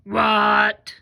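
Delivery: big, loud monster voice. Shown as a demonstration. Teacher reading sight words with kids, monster voice. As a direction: boom it out like a monster. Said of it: "use our monster voice".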